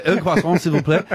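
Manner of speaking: "un croissant, s'il vous plaît" in French; French accent, laughing a little. Shown as a demonstration. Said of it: "that's speaking the language".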